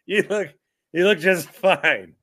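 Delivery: normal talking voice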